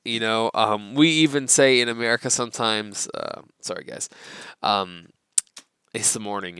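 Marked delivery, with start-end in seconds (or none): 3.1-6.6 sleepy apology. Real, not acted. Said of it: "so I'm rubbing my eyes".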